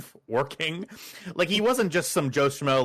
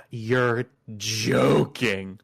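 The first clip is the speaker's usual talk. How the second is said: slow, drawn-out disbelief; stunned disbelief; 3 semitones lower, much slower than usual. Retold or imitated. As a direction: stretch it out in mock disbelief. Acted stunned reply in retold story.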